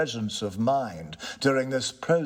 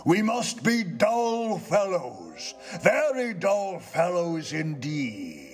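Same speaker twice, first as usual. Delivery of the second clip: slow, higher, struck by the idea; a touch of ghost voice; 8 semitones higher than usual. Acted. Ghost, surprised by the tenant's point, agrees slowly. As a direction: muse it as a dawning realisation.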